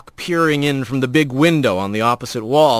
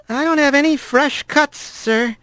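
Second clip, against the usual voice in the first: put-on higher, nervous young voice; a boy rat asking carefully; 11 semitones higher than usual. A narrator's reading. Young rat questioning the stern father, nervous and high.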